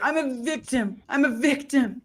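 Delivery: high-pitched mocking victim impression. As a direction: ham up the poor-me act, pitched high. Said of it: "He had better acting".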